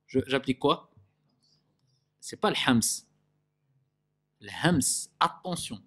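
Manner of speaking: ordinary talk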